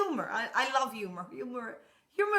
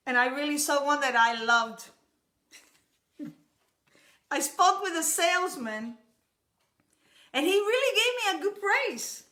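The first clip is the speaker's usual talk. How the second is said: ordinary talk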